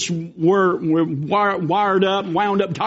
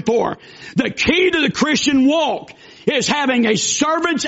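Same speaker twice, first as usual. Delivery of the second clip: raised, driving pulpit voice; fervent preaching; 4 semitones higher than usual. Acted. Preacher urging the church to be servants, fervent preaching. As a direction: preach it out to the pews, building.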